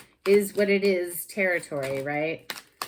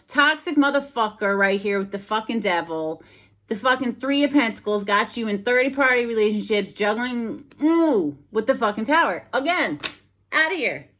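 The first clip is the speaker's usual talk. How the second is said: louder, higher, worked-up tirade; fired-up rant at the cards; heated rant; 4 semitones higher, 7 dB louder than usual. Real, not acted. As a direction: tear into the toxic ex. Ranting at toxic ex in reading.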